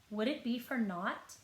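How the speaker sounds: plain reading voice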